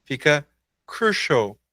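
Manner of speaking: usual speaking voice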